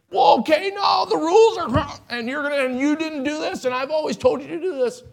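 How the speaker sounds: parent losing temper. Retold or imitated. Preacher imitating a fed-up parent scolding the kids, high ranting. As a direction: play a fed-up parent whose top finally blows. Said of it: "And then my top blows".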